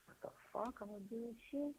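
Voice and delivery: high, soft, far-off disbelief; incredulous inner voice. Retold or imitated. Ex-inmate recalling his first search, stunned and high. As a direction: ask yourself in stunned disbelief. Retelling his own prison thoughts.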